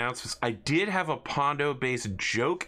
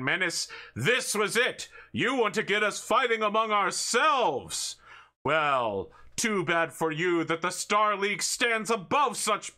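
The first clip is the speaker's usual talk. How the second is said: much higher, slow hammy hero speech; rally the room like a comic-book hero; heroic grandstanding; 9 semitones higher than usual. Acted. Hero's grand speech to villain.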